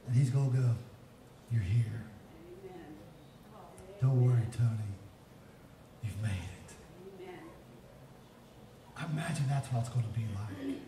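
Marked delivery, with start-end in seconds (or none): 1.5-6.8 low, unhurried comfort with pauses; reassuring welcome. Acted. Preacher voicing the Lord greeting him in heaven, reassuring. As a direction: welcome them home, tender and low.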